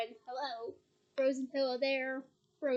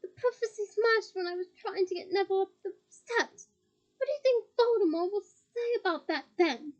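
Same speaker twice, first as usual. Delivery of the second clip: higher, loud jeering challenge; defiant taunt; 6 semitones higher, 6 dB louder than usual. Acted. Harry jeering at Bellatrix, loud and defiant. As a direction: rub it in with a sneer.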